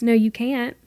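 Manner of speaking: ordinary delivery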